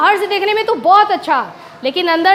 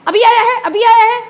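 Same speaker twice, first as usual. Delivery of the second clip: higher and louder mock scolding; mock angry scolding; 4 semitones higher, 4 dB louder than usual. Teacher playing the wrongful scolder to her class, louder mock scold. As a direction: snap at the pupil, half-acted. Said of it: "और इसको डांटने लगी".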